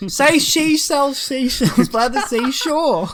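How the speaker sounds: slow, high, loud challenge; mock demanding. Passenger testing a mumbling stranger, mock demanding. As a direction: demand the tongue twister word by word.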